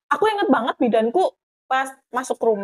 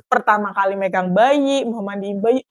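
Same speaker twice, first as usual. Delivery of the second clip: ordinary delivery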